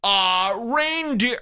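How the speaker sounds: high, grand countdown voice; mock authority. List host naming the top movie, in a grand announcer voice. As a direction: present the winner like big news. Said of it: "they say it with authority".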